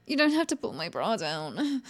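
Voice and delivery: normal talking voice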